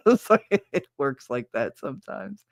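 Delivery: ordinary talk, nothing marked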